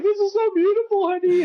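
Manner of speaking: high, hammed-up swooning voice; mock gushing. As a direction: gush over the view in a silly high voice. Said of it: "I'm up there like".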